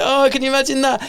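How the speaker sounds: high-pitched put-on wonder; playful mock horror. MC riffing obliviously to a silent crowd, playful high mockery. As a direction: muse aloud in a high comic voice. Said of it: "in one of my little playful M C roles".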